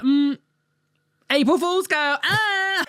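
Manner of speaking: high, mocking sing-song to a friend; mocking reply. Teasing a friend who shows off a face tattoo, high and mocking. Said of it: "I'd be like".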